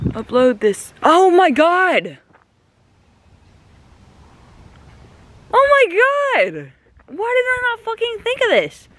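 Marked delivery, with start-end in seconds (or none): 1.0-6.7 high, loud, drawn-out dismay; shocked exclamation. Real, not acted. Realizes no car. 7.1-8.9 very high, frustrated whine; exasperated wail. Real, not acted. Frustrated at herself.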